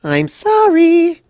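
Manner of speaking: high, slow sing-song apology. Acted. Pinkie apologizing to Rarity in a sing-song. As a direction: stretch it out like a playful tune. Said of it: "Pinkie sing-song".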